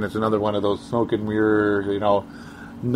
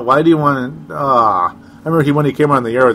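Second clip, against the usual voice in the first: usual speaking voice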